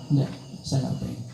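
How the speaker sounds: soft, gentle refusal; quiet refusal. Acted. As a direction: decline it softly, barely above a murmur. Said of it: "Tapi beliau menolak". (menolak dengan suara pelan)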